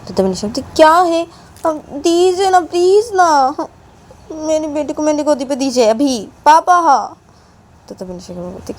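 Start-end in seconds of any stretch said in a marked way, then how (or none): 0.8-7.2 much higher, slowed whiny begging; Nisha's whining plea. Acted. Nisha begs papa for her baby, high and whiny. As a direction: whine like a child who wants it now.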